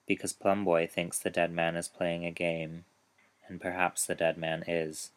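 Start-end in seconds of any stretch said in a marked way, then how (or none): none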